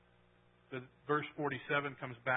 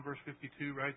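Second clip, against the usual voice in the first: ordinary talk, nothing marked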